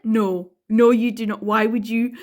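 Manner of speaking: normal talking voice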